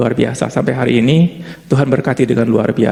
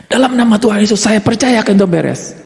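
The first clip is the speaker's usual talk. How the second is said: mock faith declaration; 7 semitones higher than usual. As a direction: shout the faith claim to convince yourself. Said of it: "Iblis kalau di sebelah melihat".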